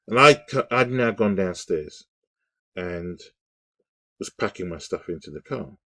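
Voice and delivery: usual speaking voice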